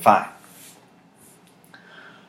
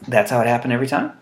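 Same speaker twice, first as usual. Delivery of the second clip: ordinary delivery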